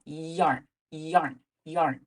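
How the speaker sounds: "Yarn" with a stretched gliding y; y sound demo. Shown as a demonstration. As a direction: lean into the y of "yarn". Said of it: "little extra y sound".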